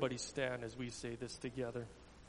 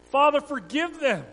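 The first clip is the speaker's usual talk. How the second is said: higher, louder pleading cry; gasped plea; 11 semitones higher, 12 dB louder than usual. Acted. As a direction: plead for them with failing breath. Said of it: "Jesus gasped".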